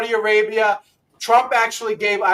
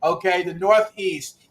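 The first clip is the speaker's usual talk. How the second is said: usual speaking voice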